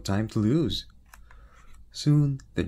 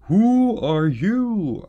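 high, loud, slow royal voice; king scoffing at the tiny knight; loud, slow mocking king; booming king; 11 semitones higher, 10 dB louder, much slower than usual. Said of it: "asked the king".

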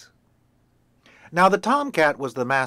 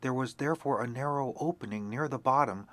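ordinary delivery, nothing marked

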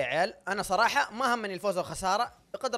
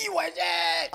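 high, loud burst of triumph; cheering shout; 11 semitones higher, 6 dB louder, slower than usual. Real, not acted. Player cheering teammate after begging him on, triumphant shout. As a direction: cheer your teammate on at full voice.

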